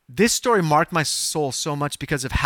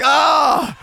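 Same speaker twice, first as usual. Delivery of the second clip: loud, high mock groan; hammed-up cry of dismay; 12 semitones higher, 7 dB louder than usual. Preacher relives seeing nobody healed, dismayed cry. Retold dismay at failed prayers.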